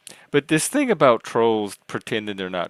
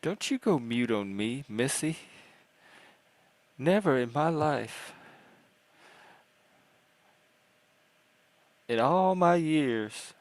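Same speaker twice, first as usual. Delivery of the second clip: prim scolding lady; 4 semitones higher than usual. Acted. Scolding a silent guest.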